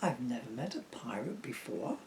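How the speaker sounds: soft storybook read-aloud; soft rhyming bedtime reading; reading to children. Acted. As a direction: lull them into the story gently. Reads rhyming picture book aloud.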